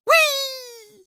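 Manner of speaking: high squeal of delight; a soaring, joyful squeal; gleeful whee. Acted. Rabbit squealing while flying through the air.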